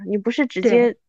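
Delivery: ordinary delivery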